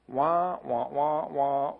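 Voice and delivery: muffled wah-wah like a cartoon grown-up; Charlie Brown adult. Imitating what kids hear from yelling parents, lightly voiced wah-wah. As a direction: drone it like the teacher in Charlie Brown. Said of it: "It's Charlie Brown adults".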